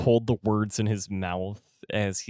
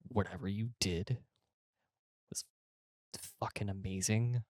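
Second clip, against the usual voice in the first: hushed, whispered aside; 8 dB quieter than usual. Acted. Manager to his fighter after the fight, hushed. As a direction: keep it quiet, for one listener only. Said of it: "You hear him whisper in your ear".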